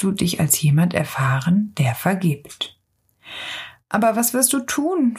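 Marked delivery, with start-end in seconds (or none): 3.9-5.2 mildly worried, lifted pitch; a touch of unease in a higher voice; uneasy question. A narrator's reading. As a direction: ask with slight concern. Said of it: "nun doch etwas beunruhigt".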